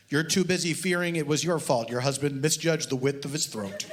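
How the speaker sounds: deadpan reading of a mock detective novel; story reading. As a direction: read it dry, like a serious audiobook. Author reads his novel aloud.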